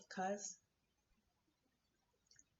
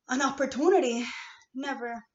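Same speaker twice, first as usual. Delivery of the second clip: loud outburst; 5 semitones higher, 12 dB louder than usual. Real, not acted. Ranting about never getting chances.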